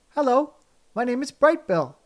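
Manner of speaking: high, bright little gosling voice; a small proud gosling announcing his name. A narrator's reading. Gosling greeting anyone who will listen, high and proud.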